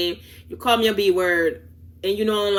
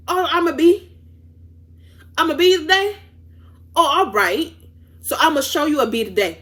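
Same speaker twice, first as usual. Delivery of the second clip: high incredulous questions settling into firm warnings; indignant comeback; 3 semitones higher than usual. Retold or imitated. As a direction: replay telling someone off, voice raised. Acted retelling of her angry comeback.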